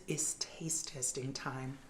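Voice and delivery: hushed, slow secret whisper. Acted. Catchphrase for tasting segment.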